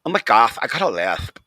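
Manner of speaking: loud, lightly hammed-up lisp. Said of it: "he goes".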